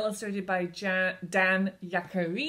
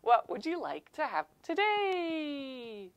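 playful shopkeeper voice; 7 semitones higher, slower than usual. Acted. Shopkeeper puppet scene.

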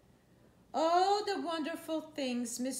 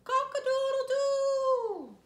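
loud, high, slow rooster crow; 13 semitones higher, 9 dB louder than usual. Shown as a demonstration. Reading aloud to a child, crowing like a rooster. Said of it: "He can go like a rooster".

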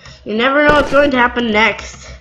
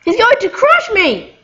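normal talking voice

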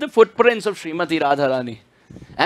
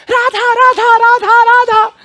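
parrot voice; 8 dB louder than usual. Retold or imitated. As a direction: parrot finally saying the name, high and loud. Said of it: "Then he started saying".

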